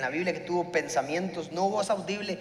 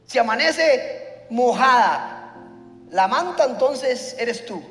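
slow, louder, deliberate terms; Gideon's challenge; 4 semitones higher, 6 dB louder, much slower than usual. Retold or imitated. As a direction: lay down the test slowly. Gideon bargaining with God.

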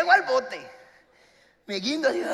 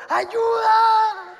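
high, loud frantic cry for help; high desperate yell begging the boat to stay; desperate shout for help; 11 semitones higher, 7 dB louder, much slower than usual. Retold or imitated. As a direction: scream for the boat to wait. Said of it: "Pero en serio así".

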